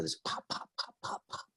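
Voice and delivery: soft voiceless pops like a board slapping water; breathy imitation of board slaps on water; imitated slapping pops. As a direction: whisper the pops of a windsurfer hitting the waves. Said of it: "I heard this slapping across the water".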